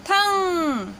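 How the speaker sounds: थंग said clearly with the nasal ng; teaching demo. Shown as a demonstration. Teacher showing students how to say थंग. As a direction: model थंग for learners. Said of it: "उच्चारण हो जाएगा".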